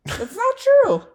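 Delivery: high-pitched protest. Real, not acted. Denying partner's tease about drinking, high-pitched protest. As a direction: protest in a squeaky, shot-up voice.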